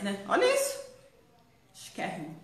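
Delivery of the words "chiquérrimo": breathy whisper of admiration. Real, not acted. Host admiring the reverse side, whispered. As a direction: whisper it like a secret compliment.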